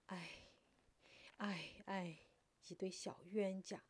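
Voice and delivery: soft, resigned sighs; sighing. A narrator's reading. Clan elder watches the young pair embrace, sighing softly. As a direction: sigh to yourself between long pauses. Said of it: "暗自叹息一声".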